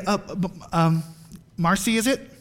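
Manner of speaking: soft, hesitant, halting mumble full of gaps; timid, fumbling. Acted. Inviting a waitress to church, timid and fumbling. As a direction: play a painfully awkward, shy inviter, very softly.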